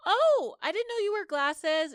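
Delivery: high squeaky surprised oh; mock surprise. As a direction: act startled at the glasses. [name] teasing about glasses.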